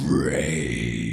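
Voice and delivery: deep, low growled word. Shown as a demonstration. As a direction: growl it out like a beast. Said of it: "Say rage in your growl voice".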